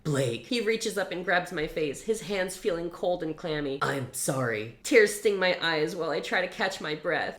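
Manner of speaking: emotional read-aloud of a novel scene; dramatic story reading. Acted. Reviewer reads the book's climax aloud dramatically.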